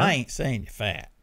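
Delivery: put-on Southern accent; folksy Southern voice; Southern guy. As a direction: play a Southern guy in your head. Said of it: "I get Southern guy".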